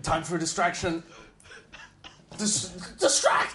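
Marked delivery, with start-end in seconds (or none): 2.4-3.5 laughing shout. Real, not acted. Player shouts distraction, laughing.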